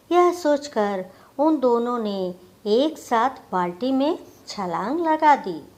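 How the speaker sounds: ordinary delivery, nothing marked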